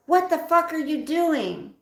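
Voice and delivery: put-on high, loud drunken yell; furious scream. Drunk father screaming at daughter from the van, furious and high. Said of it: "my father screamed".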